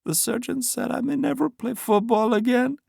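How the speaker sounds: high, drowsy, slurring voice; slurred speech. A narrator's reading. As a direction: slur it like she's drugged. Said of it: "Aline slurred".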